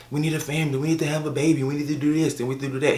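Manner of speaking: mock pressuring spouse; nagging husband. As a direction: rattle off demands as the insistent husband. Voices husband pressuring his wife.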